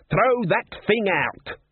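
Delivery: high, slow yelled command; shouted order. Acted. Troll yelling at Buddy to dump the guitar, high and slow. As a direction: bark the order over the noise. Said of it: "Blood turned around".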